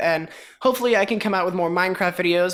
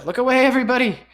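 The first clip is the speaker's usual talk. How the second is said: loud warning; 8 semitones higher, 7 dB louder, faster than usual. Real, not acted. Warning viewers about nausea effect.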